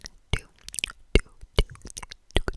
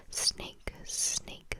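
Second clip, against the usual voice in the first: normal talking voice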